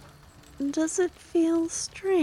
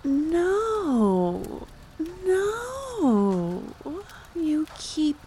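high protest; 7 semitones higher, much slower than usual. Acted. Protests being called human.

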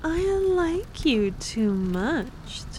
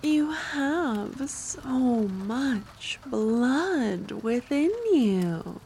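slow savouring; 3 semitones higher, slower than usual. Acted. Vampire savouring, x0.5.

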